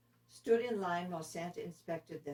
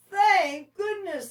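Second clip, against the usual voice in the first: much higher, louder, slow relieved cry; relieved and jolly; 14 semitones higher, 13 dB louder, slower than usual. Acted. Santa relieved at the glow, loud and slow. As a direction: sigh of relief turned jolly. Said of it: "suddenly jolly again".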